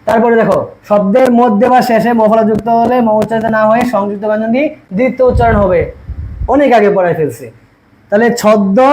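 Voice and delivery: ordinary talk, nothing marked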